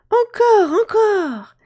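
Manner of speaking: put-on high, loud delighted boy; excited child.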